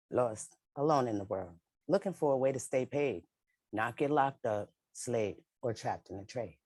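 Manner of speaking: rhythmic, low poetry recital; spoken-word poem. Acted. Speaker opening a panel with her poem, rhythmic recital.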